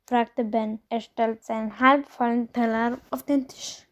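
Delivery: ordinary delivery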